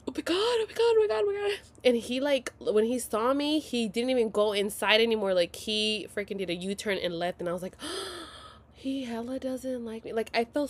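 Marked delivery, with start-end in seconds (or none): 0.1-1.6 high-pitched rush of panic; panicked. Retold or imitated. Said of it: "I was shitting bricks". 7.8-8.5 high, soft mock gasp; shocked gasp. Retelling shock as he left.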